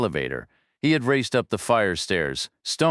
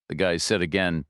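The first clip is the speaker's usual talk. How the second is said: usual speaking voice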